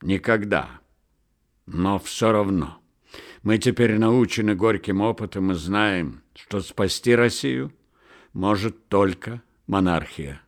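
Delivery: usual speaking voice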